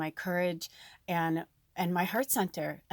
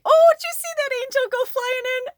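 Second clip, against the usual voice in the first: shrieks it much higher and louder, thrilled; spotting an angel mid-sentence, gasp of glee; sudden squealing wonder; delighted surprise; 13 dB louder than usual. Real, not acted.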